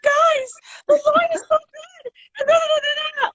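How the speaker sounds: put-on very high girlish younger self; super high young voice. Singer mimicking own recording from age nineteen, super high voice. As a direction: imitate your high teenage voice, far above your own. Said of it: "my voice was super high".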